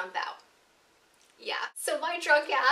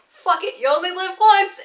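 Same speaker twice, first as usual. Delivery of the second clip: loud, higher cry of daring; reckless yell; 8 semitones higher, 14 dB louder than usual. Retold or imitated. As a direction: shout it to dare yourself. Psyching herself up to jump.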